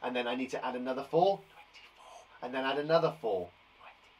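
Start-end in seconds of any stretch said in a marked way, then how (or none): 1.4-2.2 barely audible whisper; whispered aside. Pretend. Lets the answer slip under breath. 3.8-4.1 barely audible whisper; hushed, secret muttering; whispered aside. Pretend. Lets the answer slip under breath.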